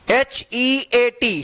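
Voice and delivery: एच ई ए टी drawn out letter by letter; slow spelling. Shown as a demonstration. Teacher spells the word for learners, एच ई ए टी stretched slowly.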